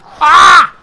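sudden loud yelp of shock; startled shout. Real, not acted. Gamer startled by his fall, sudden shout. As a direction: yelp as the character drops.

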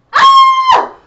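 a loud staged shriek; mock scream. Shown as a demonstration. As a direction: scream as if the monster has you.

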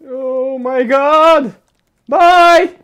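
high loud cheery goodbye; excited shout. Real, not acted. Energetic outro to viewers.

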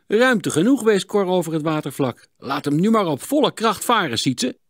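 hoog en luid aangemoedigd; enthousiaste aansporing. A narrator's reading. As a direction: spoor je maat opgewekt aan. Wijst over het water, moedigt aan. (enthousiast, hoog en luid)

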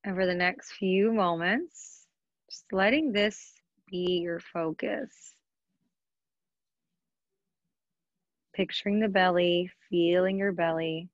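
unhurried yoga nidra guidance with long pauses; guided meditation. Acted. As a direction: guide them into relaxation, leaving space between phrases. Leads a yoga nidra practice.

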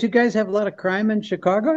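a hint of Rodney asking; Rodney Dangerfield voice. Rodney asking the storyteller about Chicago, lightly imitated. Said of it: "Yeah, he goes".

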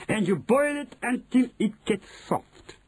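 high put-on witch voice; Grand High Witch, instructing. Grand High Witch teaching the boiling, instructing. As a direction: give the recipe step at high pitch.

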